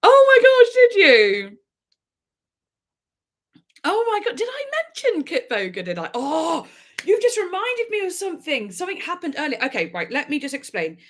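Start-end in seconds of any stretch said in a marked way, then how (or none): 0.0-1.6 loud, high-pitched gasp of delight; real squeal of excitement; excited outburst. Real, not acted. Sudden excited exclamation to viewer. 3.8-9.6 high-pitched, breaking into sudden recollection; light up as the memory hits you; really thrilled, voice lifted high; excited realisation. Real, not acted. Suddenly reminded of story, thrilled.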